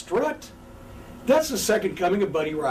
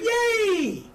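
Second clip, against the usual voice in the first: mock crowd cheer; 13 semitones higher than usual.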